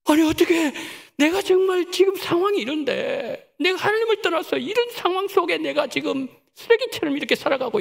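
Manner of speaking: marvel, close to tears; a touch of tearful wonder; overwhelmed with emotion. Retold or imitated. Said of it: "너무 감격했어요".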